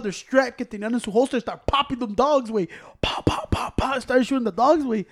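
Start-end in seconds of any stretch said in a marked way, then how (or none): 3.0-4.0 gunshot noises. Retold or imitated. Imitating gunshots.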